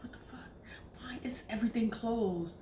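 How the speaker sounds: hushed, breathy what-the-fuck whisper; whispered disbelief. Retold or imitated. Alone, realizing everything is closed, whispered disbelief. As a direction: whisper it like you can't believe it.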